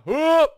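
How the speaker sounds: high, loud "whoop"; startled whoop. Real, not acted. Player stuck in the level, startled whoop. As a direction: let out a sudden whoop.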